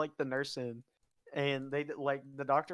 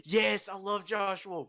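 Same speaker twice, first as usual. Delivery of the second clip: higher, slow emphatic enthusiasm; real excitement; 5 semitones higher, 4 dB louder, slower than usual. Real, not acted. Fan answering a friend about a comedian, excited. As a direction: stretch it out with raised pitch, thrilled.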